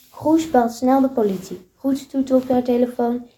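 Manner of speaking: ordinary delivery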